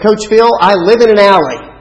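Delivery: put-on child's loud answer. Tweety calls out to coach from the van, loud and high. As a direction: blurt it out loud like a kid.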